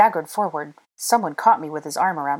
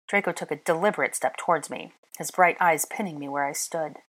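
usual speaking voice

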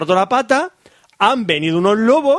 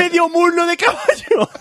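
incredulous outrage; 11 semitones higher, slower than usual. Retold or imitated. Soldier pointing out the horse leg, high incredulous cry. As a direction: cry out in high-pitched disbelief.

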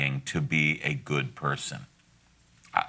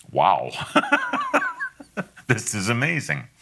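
1 amazed; 6 semitones higher than usual. Retold or imitated. Parent amazed at obedience.

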